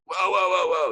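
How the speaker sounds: high-pitched whoas in a rush; retold alarmed protest. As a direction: act out trying to halt a tirade. Retold reaction to being screamed at.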